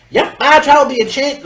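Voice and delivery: put-on high southern accent; southern-accented character voice. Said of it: "I'll do a southern voice".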